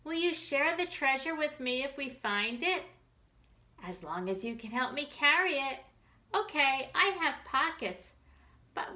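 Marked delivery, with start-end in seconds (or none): none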